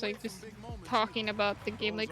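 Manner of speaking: ordinary talk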